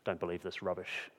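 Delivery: low, hushed tempter's voice; quiet, secretive undertone; Satan whispering. Acted. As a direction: murmur it low into someone's ear. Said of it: "is whispering in your ear".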